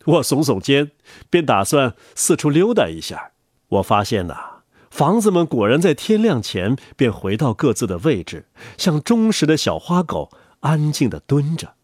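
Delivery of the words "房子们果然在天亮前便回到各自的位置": ordinary delivery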